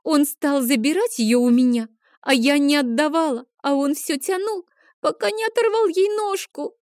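put-on high child voice climbing to a wail; upset little girl about to break down; building to sobs. A narrator's reading. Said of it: "Адель сорвалась в рыдание".

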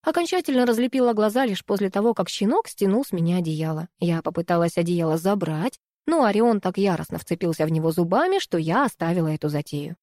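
ordinary delivery

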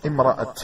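"امرأة" ending in an audible t; final ta sound. Shown as a demonstration. Teacher showing the open-ta spelling, final ta sound. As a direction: stop on "امرأة" with t instead of h. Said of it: "أحيانا تكتب بالتاء المبسوطة".

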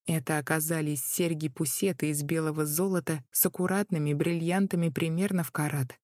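plain reading voice

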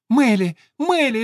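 put-on high, loud little girl calling; excited child. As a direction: clap and call out gleefully. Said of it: "захлопала Надюшка".

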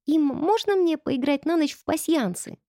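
put-on high, begging child voice; pleading little girl. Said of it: "просительно".

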